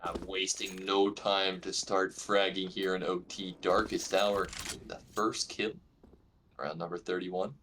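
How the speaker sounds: esports caster. Acted. Live esports play-by-play.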